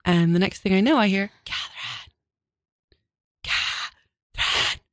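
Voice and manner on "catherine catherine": hushed urgent calling out; whisper-scream the name across the yard; whisper yelling. Retold or imitated. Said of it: "which is like a whisper scream".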